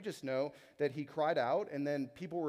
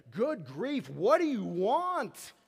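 higher and much louder, slow, fed-up sigh of a tired parent; exasperated parent; 6 semitones higher, 9 dB louder, slower than usual. Retold or imitated. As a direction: snap at a child who has cried for twenty minutes. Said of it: "his tone is like me sometimes".

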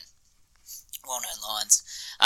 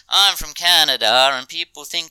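put-on Canadian kid quote; 5 semitones higher, 4 dB louder than usual. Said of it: "he replies by saying".

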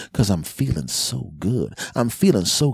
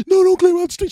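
put-on gasping horrified kid; 9 dB louder, faster than usual. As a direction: gasp then loud, very high kid plea. Kids gasping in horror.

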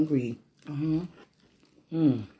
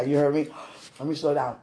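normal talking voice

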